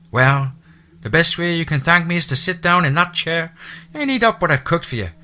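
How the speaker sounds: high, loud Irish housekeeper voice; chatty Irish brogue, pitched high. Acted. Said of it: "her lyrical accent".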